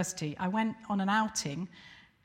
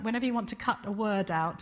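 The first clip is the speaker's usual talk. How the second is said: normal talking voice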